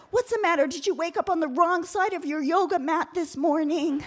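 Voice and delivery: high mock concern; imagined snarky insult; sarcastic comeback. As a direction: tease her about her yoga mat. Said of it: "I could have said".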